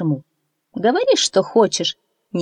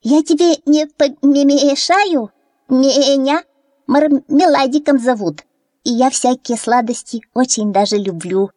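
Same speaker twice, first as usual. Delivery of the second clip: put-on kid goat voice; 7 semitones higher, 4 dB louder than usual. A narrator's reading. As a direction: ask shyly as a little goat. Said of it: "спрашивает утёнка".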